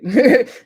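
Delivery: a quick burst of disbelieving laughter; real laugh. Real, not acted. Laughs amid her disapproval.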